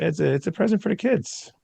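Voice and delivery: high, innocent pretend excuse; mock innocent excuse. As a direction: act all innocent, voice up high. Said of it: "I'll say".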